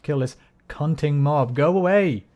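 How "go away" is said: annoyed yell. Real, not acted. Fighting mobs in game.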